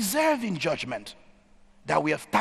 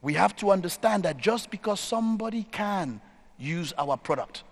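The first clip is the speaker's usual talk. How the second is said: normal talking voice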